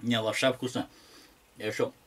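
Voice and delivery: ordinary talk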